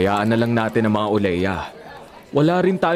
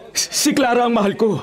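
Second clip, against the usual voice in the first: pleading; 12 semitones higher than usual. Acted. Son pleads with father.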